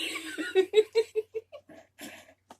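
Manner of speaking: high loud cackle; high helpless laughter; shrieking laugh. Real, not acted. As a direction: burst into a squealing laugh. Big laugh.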